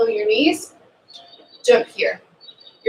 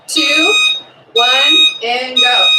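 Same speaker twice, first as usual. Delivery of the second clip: loud countdown call to start; countdown shout; 7 dB louder, much slower than usual. Real, not acted.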